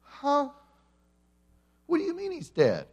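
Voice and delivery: comic disbelief, pitch climbing; baffled disciples. Retold or imitated. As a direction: stammer it out like stunned disciples. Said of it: "can't you see Peter, James, John, and the rest of them going".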